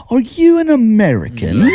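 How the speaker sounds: put-on British accent. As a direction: play the shocked British operator. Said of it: "with the British accent".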